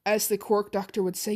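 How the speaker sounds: ordinary delivery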